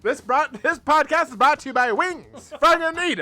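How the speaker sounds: loud high 1950s announcer; brash newsreel sponsor read; old-timey voice. Pretend. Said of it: "The old-timey voice".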